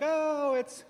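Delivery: high, drawn-out letdown over the gift; fake polite disappointment. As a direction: feign gratitude while clearly let down. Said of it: "you're like".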